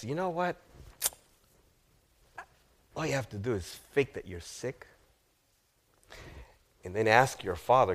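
low, hushed scheming voice; conspiratorial advice. Acted. Friend advising the lovesick prince on a scheme, low and conspiratorial. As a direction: quietly slip him a sly suggestion.

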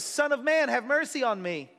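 high pleading cry for mercy. Retold or imitated. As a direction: call out to Jesus, begging. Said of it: "he begins to cry out".

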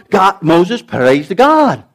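usual speaking voice

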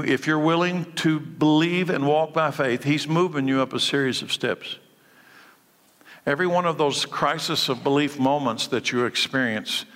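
ordinary talk, nothing marked

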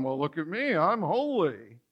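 self-righteous. Retold or imitated. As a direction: sneer it out, pleased with yourself. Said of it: "a self-righteous way of going".

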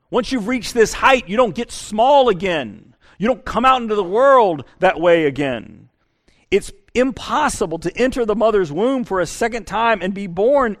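normal talking voice

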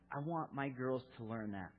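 ordinary talk, nothing marked

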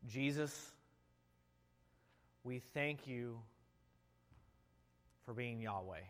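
hushed pastor's prayer; leading prayer. Acted. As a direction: pray aloud for the congregation. Leads the congregation in prayer.

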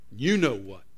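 low, knowing accusation; low-voiced echo of a punchline. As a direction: drop the pitch and let it hang. Devil echoes the parrot's punchline.

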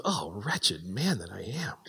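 slow, soft whisper of despair; whispered lament. Retold or imitated. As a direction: breathe it out like a confession. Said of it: "he goes".

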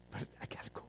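hushed, hurried whisper; acted whisper. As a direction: whisper it quickly, acting impatient to leave. Acts out pastor eager to go.

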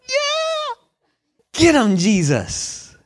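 high whooping cheer, then slow; cheering crowd. Retold or imitated. Crowd cheering Jesus on against the Pharisees, excited. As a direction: egg him on like a fan. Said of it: "everybody's like".

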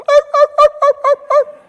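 rapid string of very high, loud yelps; frightened dog yelps. Retold or imitated. Preacher imitates the scared dog running off, shrieking yelps. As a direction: yelp repeatedly like a fleeing dog. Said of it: "And the dog go".

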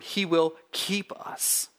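"keep" drawn out after a slow lead-in; stress on keep. Shown as a demonstration. Preacher underlines the next word for his church, stress on "keep". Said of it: "We could underline the next word".

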